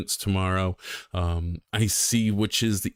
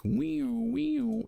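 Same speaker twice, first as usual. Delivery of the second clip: high, squeaky siren wail, softer; police siren imitation; 4 dB quieter than usual. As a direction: mimic a siren with a falling wee-oo. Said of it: "They've got their sirens going".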